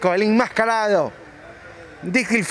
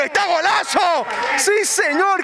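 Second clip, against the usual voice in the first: breakneck goal commentary; 6 semitones higher than usual. Real, not acted. Goal called in live commentary.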